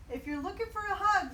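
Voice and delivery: fast, high, loud blurt; lonely cactus offering the owl a hug; nervous offer. A narrator's reading. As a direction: rush it out awkwardly.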